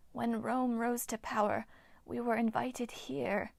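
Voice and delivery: eerie breathy whisper of an ancient nymph; ghostly nymph whisper. Acted. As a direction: hiss it like a withered water spirit. Nymph Hagno speaks.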